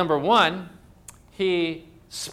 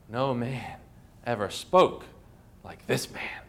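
much lower and softer, dropping to a near whisper at the end; awed hush; 6 semitones lower, 6 dB quieter than usual. Retold or imitated. Officers telling the chief priests, hushed awe. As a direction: let the words sink into reverent awe.

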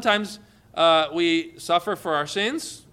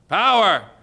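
Power thrown higher and loud; higher preaching shout; 4 semitones higher, 8 dB louder than usual. Real, not acted. Preacher repeating the word Power, higher and louder. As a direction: echo it, higher and bigger.

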